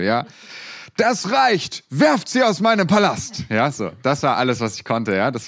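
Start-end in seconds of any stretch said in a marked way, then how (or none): 1.0-3.4 put-on high, loud kingly shout as a child actor; royal shout. Child king on stage shouting his one line, loud. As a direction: bellow the king's line to throw them out. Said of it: "meine einzige Zeile in dem ganzen Musical".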